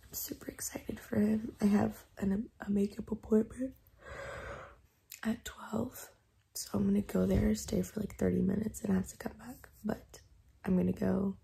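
soft, hushed morning voice with little voice in it; whispering. Real, not acted. Vlogger just woken up with no voice, whispering. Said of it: "I'm, like, whispering".

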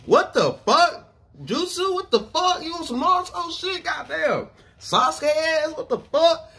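high-pitched baffled disbelief; incredulous outburst. Real, not acted. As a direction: react in high, stunned disbelief. Reacting in disbelief to the threat.

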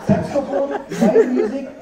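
ordinary delivery

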